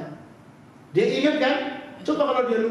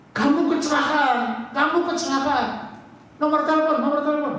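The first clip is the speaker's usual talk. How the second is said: mock urgent shout; 6 semitones higher than usual. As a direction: press the dazed man for a number. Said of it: "Orangnya teriak".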